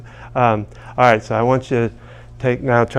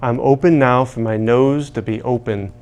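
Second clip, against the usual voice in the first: emphatic affirmation lead; slower than usual. Shown as a demonstration. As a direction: chant each phrase firmly for them to repeat. Leads call-and-response tapping affirmations.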